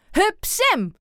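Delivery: high cheering call. A narrator's reading. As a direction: cheer him on from the sidelines. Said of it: "roept Aap".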